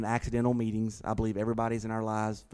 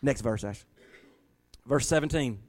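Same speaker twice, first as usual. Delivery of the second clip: ordinary talk, nothing marked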